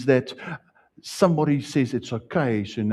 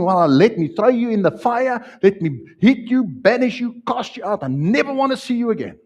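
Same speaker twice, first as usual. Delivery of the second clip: much higher, louder mock threat of a punishing God; imagined harsh God; 11 semitones higher, 5 dB louder than usual. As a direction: play the wrathful judge he rejects, overdone. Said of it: "God's not saying".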